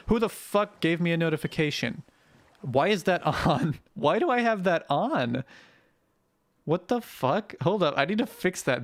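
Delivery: high, incredulous outburst; exasperated surprise. Real, not acted. Streamer startled by a notification alert, exasperated and high.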